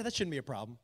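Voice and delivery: low, quiet, offhand; casual reassurance. Retold or imitated. Guy at the counter waves off edibles, casual. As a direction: toss it off softly and casually.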